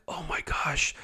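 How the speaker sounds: hushed, breathless realization; awed whisper. Retold or imitated. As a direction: whisper it as it dawns on you. Moment of revelation.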